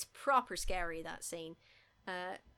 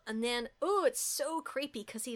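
ordinary delivery

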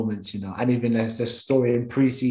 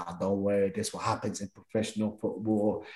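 put-on lower, softer, reassuring older man; calm manager; 4 semitones lower, 7 dB quieter than usual. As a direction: comfort the upset youngster gently. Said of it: "one manager that I think is more calm".

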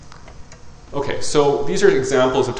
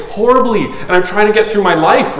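louder, higher, hammed-up misery; mock plaintive complaint; 9 semitones higher, 7 dB louder than usual. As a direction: whine it like a struggling friend. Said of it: "and then I say".

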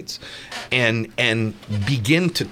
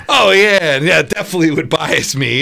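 loud, fast, hammed-up juror blurting it out; loud mock juror bragging about bias; mock eager juror; 5 semitones higher, 8 dB louder than usual. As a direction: play a juror overeager to dodge duty, loudly. Mock juror answering judge.

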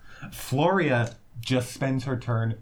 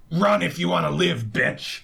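shouted threat; 4 semitones higher than usual. Acted. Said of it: "she shouts to Floria".

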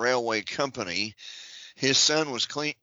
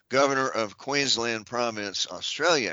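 normal talking voice

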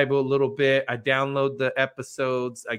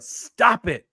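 sharp, high mock yell; yelled stop; 9 semitones higher, 4 dB louder than usual. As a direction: snap it out like shouting down a voice. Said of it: "I yelled".

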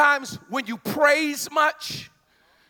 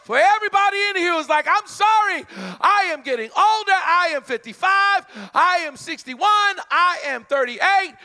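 preaching shout; 6 semitones higher than usual. Real, not acted. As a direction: drive it home to the church.

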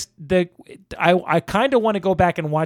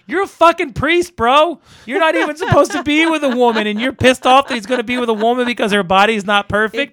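much higher and louder, outraged; indignant outburst; 7 semitones higher, 4 dB louder than usual. Real, not acted. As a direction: vent your disgust at the priest. Outraged at the priest character.

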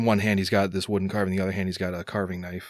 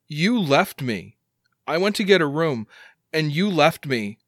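higher, pressing, louder; hurt accusation; 7 semitones higher, 4 dB louder than usual. Acted. Son accuses his father of leaving him, hurt. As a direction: accuse him, pitch raised and wounded.

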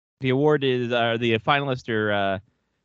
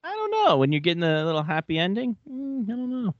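mock coy; 7 semitones higher than usual. Playing the coach joking about his massage, mock coy. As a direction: sing it up high, feigning innocence.

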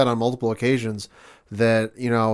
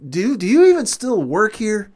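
retold mock-incredulous jab, high and louder; raised, exaggerated disbelief, quoting a heckler; Miz's taunting question; 10 semitones higher, 6 dB louder than usual. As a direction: needle them with a high-pitched question. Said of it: "is Miz brings it up".

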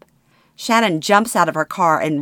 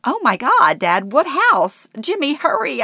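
much higher panicked cry; scream; 9 semitones higher than usual. Acted. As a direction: scream in alarm to the driver. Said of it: "I scream".

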